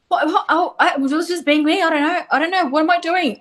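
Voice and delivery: high, bewildered stammer; flustered. Retold or imitated. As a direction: act out being totally baffled. Retelling own confused, flustered reply.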